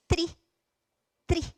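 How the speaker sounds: "Tri" with a crisp T; "Tri" com T bem marcado; clear T sound. Shown as a demonstration. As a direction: say "Tri" with a sharp English T. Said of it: "tem som de T mesmo".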